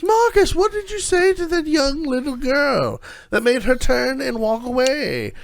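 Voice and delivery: high, loud haughty noble lady voice; snooty noblewoman. Acted. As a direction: look down your nose at the shopkeeper. Haughty noblewoman scolding shopkeeper.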